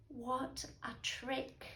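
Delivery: usual speaking voice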